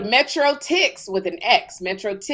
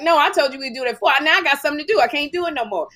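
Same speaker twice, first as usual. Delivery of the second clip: retold friend's refusal; 7 semitones higher, 3 dB louder, much faster than usual. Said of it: "Caroline's like".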